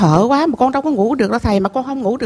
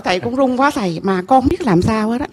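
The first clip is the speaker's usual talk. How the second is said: ordinary delivery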